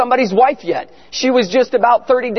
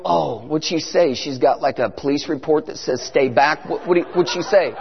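hushed anxious question; 7 semitones lower, 5 dB quieter than usual. Retold or imitated. The pastor asking Bill what Ginger said, low and nervous. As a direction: ask it low, bracing. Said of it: "I go".

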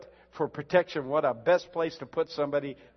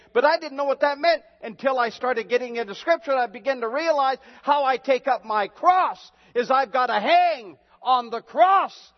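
higher and louder, rising testimony; fervent preaching; 8 semitones higher, 6 dB louder than usual. Acted. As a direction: build the fire as you testify. Preacher building fervour.

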